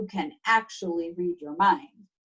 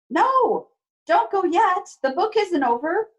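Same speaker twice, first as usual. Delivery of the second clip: high, loud plea to stay; playful plea; 9 semitones higher, 4 dB louder than usual. Acted. Reader begging the kids not to leave yet, high and loud. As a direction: call them back before they go.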